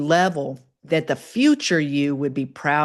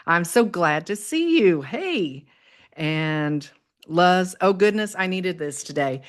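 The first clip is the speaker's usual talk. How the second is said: normal talking voice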